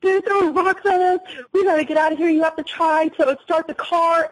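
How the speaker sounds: high-pitched, panicked pleading, retold; hysterical. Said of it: "I just absolutely lose it".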